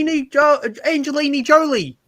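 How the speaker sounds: put-on high, loud Kiwi-accented name; crazy New Zealand accent. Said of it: "like, a crazy New Zealand accent".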